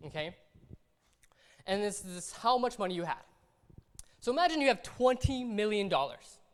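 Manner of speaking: ordinary talk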